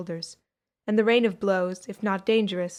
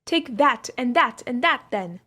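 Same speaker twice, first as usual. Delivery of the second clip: fierce cry; 4 semitones higher than usual. A narrator's reading. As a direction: Take that, like a whip crack. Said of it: "cried Cleo".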